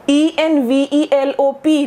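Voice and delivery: ordinary talk, nothing marked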